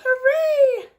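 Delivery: high, loud hooray; cheer. Acted. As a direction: shout for joy. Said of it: "Squirrel says".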